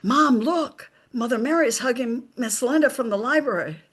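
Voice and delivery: put-on high, excited child's voice calling out; little girl. A little girl calls to her mom in church, high and bright. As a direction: pipe up like a small child tugging at mom. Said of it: "the little girl in front of me".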